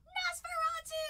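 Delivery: very high, drawn-out shriek of the name; mock horror at pulling Nosferatu again; dramatic shriek.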